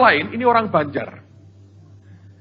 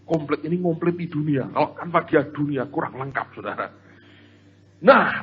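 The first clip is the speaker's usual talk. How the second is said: usual speaking voice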